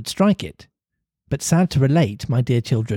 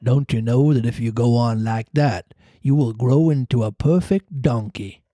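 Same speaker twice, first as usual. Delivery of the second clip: ordinary delivery, nothing marked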